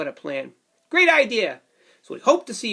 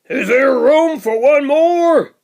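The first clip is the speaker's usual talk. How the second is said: polar bear roars; 6 semitones higher, 9 dB louder, slower than usual. Acted. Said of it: "Polar bear roars".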